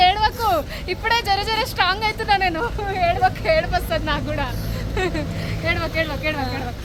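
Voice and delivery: high, loud, on the verge of tears; fighting back tears while comforting her; real tears, begging her not to cry; tearful farewell. Real, not acted. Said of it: "నాకు కూడా ఏడుపు వస్తుంది మళ్ళీ".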